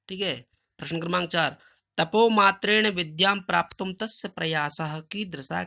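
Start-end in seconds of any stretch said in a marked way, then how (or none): 2.0-5.6 slow recitation. Shown as a demonstration. Teacher recites Sanskrit question.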